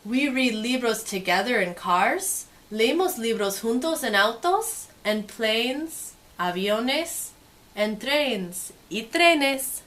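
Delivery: lively, sing-song storybook read; reading to children. Acted. Teacher reading a picture book to kids, lively and sing-song. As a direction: make the page sparkle for little listeners.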